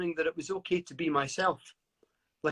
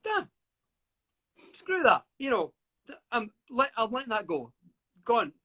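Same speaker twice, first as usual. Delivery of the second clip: defiant; 4 semitones higher than usual. Retold or imitated. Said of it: "And I thought".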